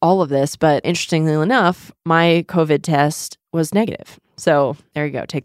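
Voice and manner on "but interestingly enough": ordinary delivery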